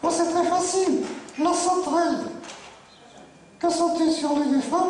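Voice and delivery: high, sure-of-herself puppet voice; cheeky puppet. Acted. Puppet explaining the riddle's answer, high puppet voice. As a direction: explain it as a high-pitched dummy.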